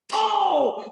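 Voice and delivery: high, drawn-out crowd cry; crowd pop. Retold or imitated. Fan re-enacting the crowd at the Brogue Kick, excited. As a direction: imitate a crowd popping for a big move. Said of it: "and we're like".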